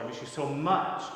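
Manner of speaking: "much" made the final peak; stress on MUCH. Shown as a demonstration. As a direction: punch the word "much". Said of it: "giving different stress to words".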